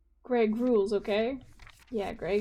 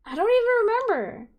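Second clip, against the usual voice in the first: much higher, louder hyper voice; excited character voice; 11 semitones higher, 5 dB louder than usual. Acted. Hyper friend protesting at band practice, high loud voice. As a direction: pitch it up, bouncy and loud.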